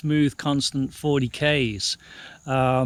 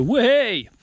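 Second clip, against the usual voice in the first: excited whoop; 9 semitones higher, 4 dB louder than usual. Retold or imitated. Describing opening the throttle fully.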